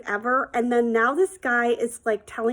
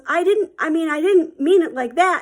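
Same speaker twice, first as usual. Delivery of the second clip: higher, stammered protest; mock panicky excuse, raised voice; flustered backpedalling; 6 semitones higher, 5 dB louder than usual. Stranger defending himself to angry dad, flustered.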